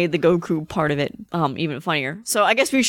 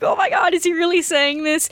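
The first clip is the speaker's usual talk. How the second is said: incredulous; 9 semitones higher than usual. Retold or imitated. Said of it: "is just like".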